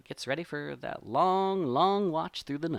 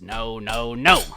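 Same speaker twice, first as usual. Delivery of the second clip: frustrated fox; 11 dB louder, slower than usual. Acted. Fox finds tracks, is frustrated.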